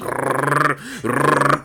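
trilled buzzing noise; imitated alarm buzz. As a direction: mimic a vibrating alarm.

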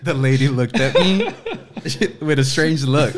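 real laughter breaking through narration; laughing. Real, not acted. Recalling the casting office mix-up, laughing loudly. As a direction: laugh hard, high and loud, while telling it. Laughing while telling the story.

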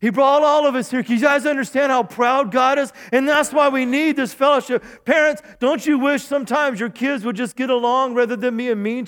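high, loud, driving sermon delivery; full-force preaching. Real, not acted. Pastor urges congregation to get along, full-force preaching. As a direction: preach hard, pressing every phrase home.

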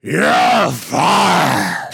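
drawn-out, hammed-up shout; Vince McMahon. Promoter threatening his wrestler, huge mock firing shout. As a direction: bellow it like a wrestling boss. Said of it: "That is such a pitch perfect".

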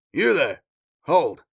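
loud, short order called out; commanding call. A narrator's reading. Marine stopping workers hiding something, commanding. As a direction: halt them with a firm shout.

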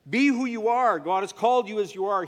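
rousing exhortation. Acted. Scene: preacher telling listeners to be themselves, loud exhortation. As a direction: urge them boldly.

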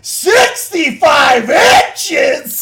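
drawn-out, loud, high cry; excited shout. Real, not acted. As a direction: shout it slowly, thrilled. Excited about TV.

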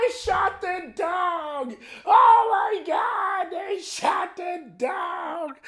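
a mother losing it as the raid unfolds; mom panicking. Retold or imitated. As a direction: hysterical mock mom, climbing into screams. Mom narrating police raid, panicking.

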